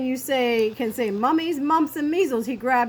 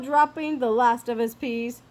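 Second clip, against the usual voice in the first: ordinary delivery, nothing marked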